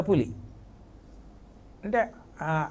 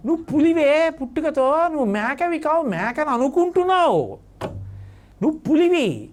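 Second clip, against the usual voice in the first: forceful tiger; 6 semitones higher than usual. Acted. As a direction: declare it boldly like a big tiger. Tiger asserts cub's nature. (పెద్ద పులి పిల్లకు గట్టిగా నొక్కి చెబుతూ, ఎత్తైన గొంతు)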